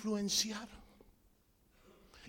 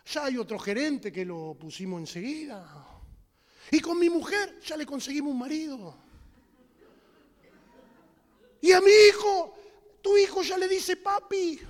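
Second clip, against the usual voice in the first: blunt growing announcement; 8 dB louder than usual. Acted. Saint Peter telling the man everyone moved on, ending loud. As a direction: hammer home that he's replaced.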